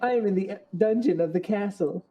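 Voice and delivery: Zelda voice. Acted. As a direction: draw out the plea for help. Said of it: "Now you have to give her the whole".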